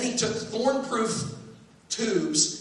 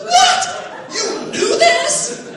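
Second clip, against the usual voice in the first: much higher, loud shocked squeal; outraged disbelief; 10 dB louder, much slower than usual. Retold or imitated. Boy realizing dad knew, high loud squeal. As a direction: shriek with betrayed surprise. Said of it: "I said".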